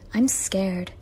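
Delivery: normal talking voice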